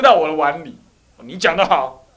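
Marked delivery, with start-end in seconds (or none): 1.2-2.0 loud, gruff officer blurting praise; officer's rough praise. Retold or imitated. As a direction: bark it like a pleased army officer. (长官粗声大嗓地夸奖)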